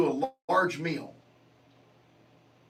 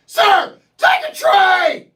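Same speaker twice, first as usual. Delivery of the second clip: even higher and louder, slow barked order; bigger mock yell; 13 semitones higher, 13 dB louder, much slower than usual. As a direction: blast it at the customer. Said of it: "they yell at you".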